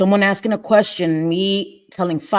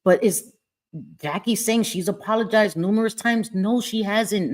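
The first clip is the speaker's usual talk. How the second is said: ordinary talk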